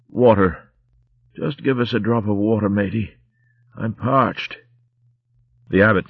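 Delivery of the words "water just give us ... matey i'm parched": put-on weak, rough old pirate rat; a dying corsair's last words; dying sea rat voice. Dying Romska begs water.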